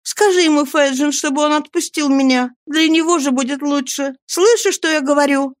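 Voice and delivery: high-pitched, agitated female voice; woman's indignant high cry. A narrator's reading. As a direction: protest urgently, pitch way up. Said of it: "вскричала Нанси, топнув ногой".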